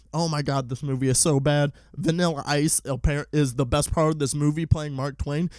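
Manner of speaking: ordinary talk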